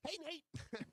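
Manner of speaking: mimicked very high puppet-like hello; Howdy Doody. Imitating co-host greeting someone important, Howdy Doody voice. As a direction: greet in a squeaky put-on falsetto. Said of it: "But the howdy doody".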